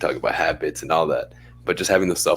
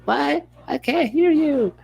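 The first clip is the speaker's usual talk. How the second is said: much higher, puzzled mock caller voice; confused patient; slower than usual. Patient on the phone who can't hear the receptionist, high puzzled. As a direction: squeak it like a baffled caller.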